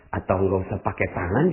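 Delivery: ordinary talk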